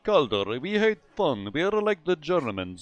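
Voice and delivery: hammed-up mock Russian accent; Russian-accent parody of the enemy team; fake Russian accent. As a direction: play a cartoon Russian drafter in a Russian accent. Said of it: "my fake Russian accent is amazing".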